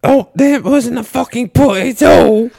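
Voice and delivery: high, loud, slow mock British rant; hammed-up posh old lady outraged at a putt; angry old Englishwoman. Mocking English golf fans, loud high mock British rant. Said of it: "this old cat on the side, and she's like".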